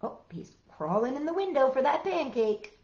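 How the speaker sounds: normal talking voice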